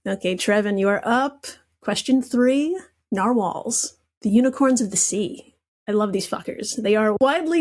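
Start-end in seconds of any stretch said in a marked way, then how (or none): none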